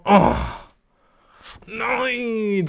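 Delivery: höher und leiser, mock weinerlich; gespielte Verzweiflung. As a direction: jammern um das Sandwich. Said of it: "Ich wär so". (leicht weinerliches Nein)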